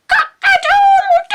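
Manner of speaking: very high, loud crowing cock-a-doodle-doo; storyteller crowing like a cockerel; rooster crow. Acted. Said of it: "Wake up and shout".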